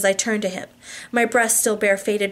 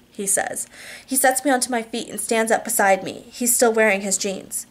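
plain reading voice

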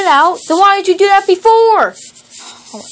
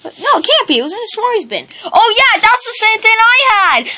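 ordinary talk, nothing marked

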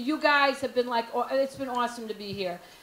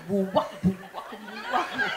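low mouthed wah-wah bass line; bad porn music; 6 semitones lower than usual. Pizza guy shows up to bad music, imitated low wah-wah riff. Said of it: "with that bad music".

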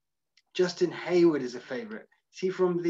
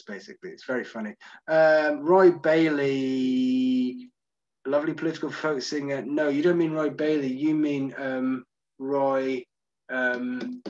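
usual speaking voice

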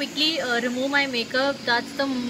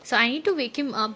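ordinary talk

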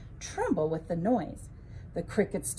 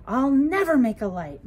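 angry yell; 3 semitones higher, 7 dB louder than usual. A narrator's reading. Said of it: "he yelled".

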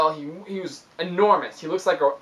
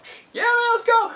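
Kyle's eager reply. Retold or imitated. Kyle voiced answering the invitation.

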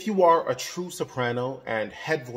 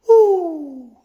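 loud, high cheering "Woo!"; head voice woo; 12 dB louder than usual. Shown as a demonstration. Coach demonstrating a head voice whoop. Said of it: "sort of make some sounds".

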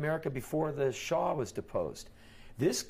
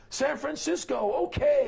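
mock excited cry, louder and higher; excited greeting; 14 semitones higher, 7 dB louder than usual. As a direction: cheer it like a welcoming local. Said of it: "They're like".

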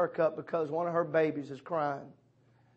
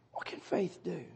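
much softer echo of "What can faith do?"; hushed repeat; 9 dB quieter than usual. Shown as a demonstration. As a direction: let "What can faith do?" drop to a near hush.

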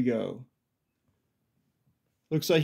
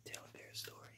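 whisper; 29 dB quieter than usual. Real, not acted. Mother whispering a prompt to her child.

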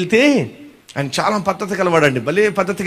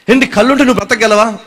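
usual speaking voice

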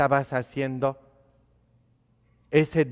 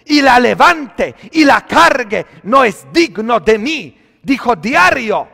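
loud, high preaching cry; fiery sermon delivery; full-force preaching; 10 semitones higher, 13 dB louder than usual. Acted. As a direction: preach it out to the whole church.

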